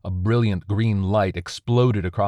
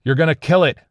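high, loud desperate yell; a soldier yelling at his superior officer; shouting back; 9 semitones higher, 8 dB louder, faster than usual. Acted. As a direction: shout him down to save it. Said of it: "Slansky shouted back".

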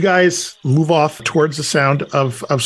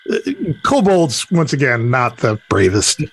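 usual speaking voice

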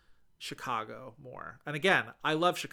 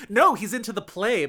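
louder and higher, still laughing; amused excitement; 7 semitones higher, 12 dB louder than usual. Real, not acted. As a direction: fire back the joke, delighted. Laughing back at the pun.

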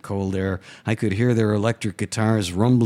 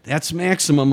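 mild yell; 7 semitones higher, 3 dB louder than usual. A narrator's reading. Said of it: "I yelled".

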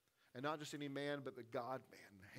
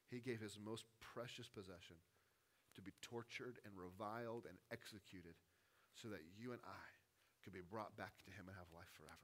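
much softer, lower, intimate sermon voice; hushed preaching; 5 semitones lower, 7 dB quieter than usual. Real, not acted. Preacher hushing the congregation over the cross, softly. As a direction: drop the voice to a hush over the sacrifice.

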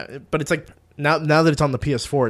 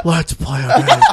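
Jigsaw voice; 3 semitones higher, 6 dB louder, slower than usual. Retold or imitated. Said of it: "can I hear your Jigsaw again".

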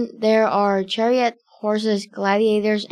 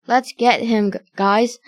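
ordinary delivery

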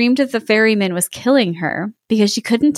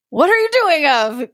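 cross giant; 6 semitones higher than usual. Acted. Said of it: "he cried, waking up very cross".

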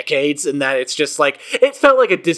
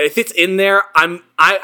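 ordinary talk

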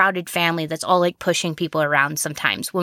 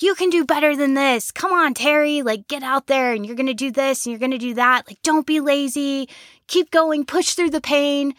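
soccer coach voice; 7 semitones higher than usual. Retold or imitated. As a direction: drive the player on like a tough coach. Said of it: "It's that voice that I'm hearing".

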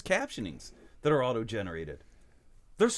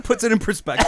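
laughing; 9 semitones higher, 7 dB louder than usual. Real, not acted. Laughing through the joke.

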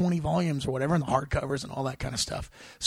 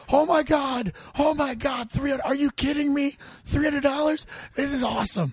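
thrilled; 11 semitones higher, 3 dB louder than usual. Retold or imitated. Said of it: "be like".

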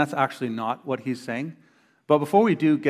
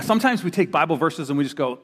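usual speaking voice

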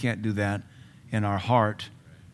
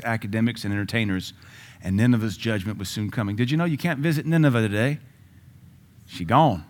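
ordinary talk, nothing marked

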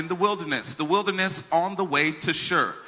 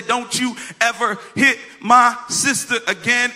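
louder, higher retold threat; angry warning; 5 semitones higher, 5 dB louder than usual. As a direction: hammer out a protective brother's warning. Retold threat while pelting bully.

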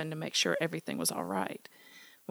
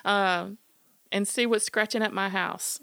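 calling dogs; 5 semitones higher, 6 dB louder than usual. Acted. Smitty calls his dogs from the window, loud high holler. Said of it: "called out".

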